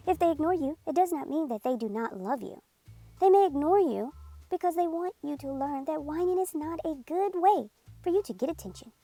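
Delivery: usual speaking voice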